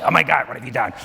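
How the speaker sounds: loud, exaggerated Bronx accent; New York accent. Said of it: "cracking out your New York accent".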